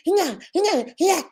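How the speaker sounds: high imitated baby fussing; baby whimper. Mother imitating her baby crying in the crib, high whimpers. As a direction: mimic the newborn's little cries. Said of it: "I started hearing".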